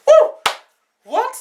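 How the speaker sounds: very high startled what; shocked what. Retold or imitated. When the driver locks the door, shocked high what. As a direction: shoot up in alarm on what. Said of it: "And I was like".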